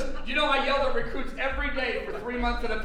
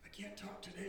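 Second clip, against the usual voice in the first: barely-there, much softer voice of a preacher who has lost his voice; a mock lost voice, far softer; hoarse voice; 18 dB quieter than usual. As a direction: croak it out as if your voice is gone. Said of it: "when your voice is hoarse".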